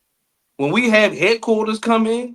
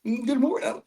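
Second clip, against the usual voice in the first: mock proper greeting; 6 semitones higher, 3 dB quieter than usual. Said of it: "I don't go in and be like".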